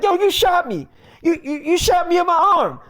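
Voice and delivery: high-pitched, stammering disbelief; acting out a wounded friend's alarm; shocked protest. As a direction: cry out as if just shot. Acted reaction to being shot.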